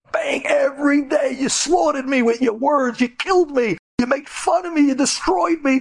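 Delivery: high, pained wail of accusation; anguished cry. Retold or imitated. Victim crying out to tormentors, high anguished wail.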